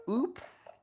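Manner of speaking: high sudden yelp of oops; sharp high oops of surprise; startled oops. Real, not acted. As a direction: yelp it out as something slips. Fumble mid-stream.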